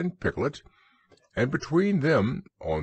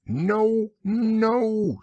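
much higher, fussy protest; fussy Owl; 13 semitones higher, 4 dB louder than usual. Acted. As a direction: flap about fussily, high and quick. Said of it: "getting quite fussy".

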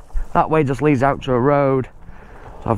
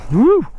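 short, high, loud "woo"; startled whoop; 10 semitones higher, 5 dB louder than usual. Real, not acted. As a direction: let out a quick high whoop. Bike slips on rough trail.

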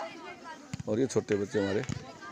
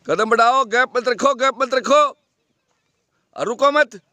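shouted urging; 11 semitones higher, 7 dB louder, slower than usual. Real, not acted. Coach urging runner on track.

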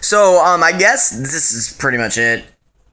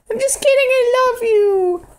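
mock crying; 4 dB louder than usual. Dog character apologising to owner, high fake crying. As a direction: whimper it with put-on tears.

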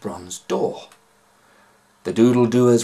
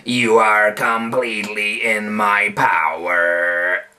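slow, grating pirate chief; low grating villain; 4 dB louder, slower than usual. A narrator's reading. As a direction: grind the words out slowly. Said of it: "his low, grating voice".

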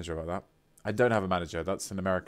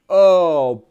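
delighted gasp; 7 semitones higher, 14 dB louder than usual. Real, not acted. Reacting to a gift.

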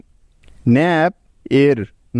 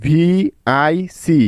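spelled out slowly; much slower than usual. Shown as a demonstration. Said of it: "সুতরাং".

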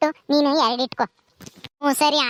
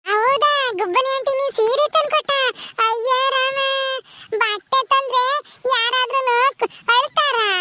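put-on high, slow-starting kid voice; incredulous child; 9 semitones higher than usual. Child amazed anyone cries over clothes, childlike. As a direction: marvel like a small child. Child character marvels at the tears.

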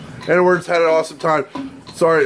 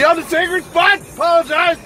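high-pitched heckle at a passer-by; heckling a man about cigarettes, belligerent yell; 14 semitones higher than usual. Real, not acted. Holler it out in a much higher voice. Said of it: "I'm yelling at golfers".

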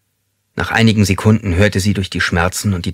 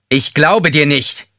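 slow, loud, higher bark; harsh snap; 8 semitones higher, 4 dB louder, slower than usual. A narrator's reading. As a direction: bark it at her slowly. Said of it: "herrschte er".

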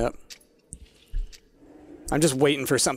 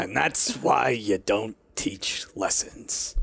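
slow, low deadpan delivery; 6 semitones lower, 7 dB quieter, much slower than usual. Acted. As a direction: drop the punchline dryly. Deadpan punchline on the show quote.

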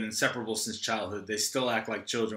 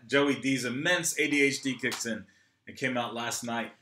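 ordinary talk, nothing marked

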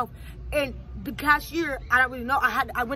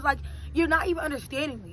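ordinary delivery

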